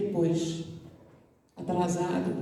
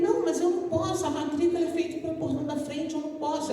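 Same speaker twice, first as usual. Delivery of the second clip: higher and fast, nervous; anxious protest; 6 semitones higher, faster than usual. Retold or imitated. The anxious student protests to the girl that he cannot enter, high and fast. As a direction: rush out the worried excuse.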